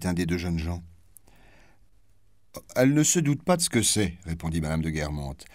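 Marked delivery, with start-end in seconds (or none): 2.8-4.2 higher, louder, disdainful duchess. Acted. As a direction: toss it off with scorn. Duchess's cutting reply.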